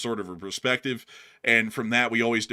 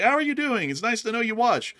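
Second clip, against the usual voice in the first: cheerful greeting; 11 semitones higher, 3 dB louder than usual. Retold or imitated. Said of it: "and I'm like".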